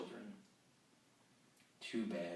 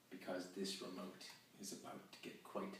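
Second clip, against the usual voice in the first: whisper; 11 dB quieter than usual. Acted. Leans in, teasing whisper.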